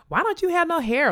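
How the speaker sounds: put-on high, curious child's question; child asking. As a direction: ask innocently like a little boy. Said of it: "ask me, like".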